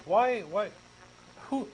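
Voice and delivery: ordinary talk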